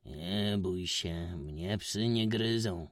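strange stranger's calm. A narrator's reading. Stranger calming frightened Witek about the dog, slow and odd. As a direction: soothe the boy, slowly and strangely. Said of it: "uśmiechnął się dziwnie".

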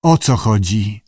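usual speaking voice

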